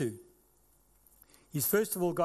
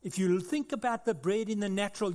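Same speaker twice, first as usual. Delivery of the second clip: ordinary talk, nothing marked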